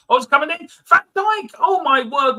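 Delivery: high, loud burst of match excitement; excited commentary. Real, not acted. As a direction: shout it like a commentator at a near goal. Live commentary as goal attempt nearly scores.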